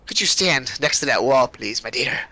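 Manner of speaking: lightly voiced hag, dropping low. Hag orders a prisoner to the wall, lightly voiced, trailing low and soft. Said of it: "And she goes".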